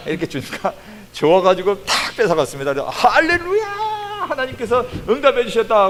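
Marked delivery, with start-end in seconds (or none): 2.9-5.9 high, loud mock hallelujah; imitating a friend's giddy shout of praise; gleeful cheer. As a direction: crow with delight like the greedy friend. Friend grabs the money, rejoices.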